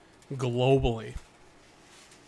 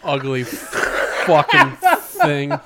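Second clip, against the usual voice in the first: laughing disgust; 10 dB louder, much slower than usual. Real, not acted. Laughing at ugly baby picture.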